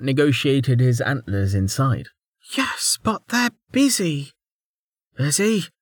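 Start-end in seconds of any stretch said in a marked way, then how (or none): 2.6-4.3 high, slow character voice; measured reply. A narrator's reading. A woman answering from the hall, measured reply. 5.2-5.7 high, incredulous echo; demanding. A narrator's reading. Servant questioning the woman's answer, demanding. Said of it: "the servant demanded".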